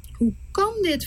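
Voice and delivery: high, dismayed question; a touch of despair; sad Moses. Acted. Moses asking the Lord in sorrow, mildly dismayed. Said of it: "Verdrietig gaat Mozes".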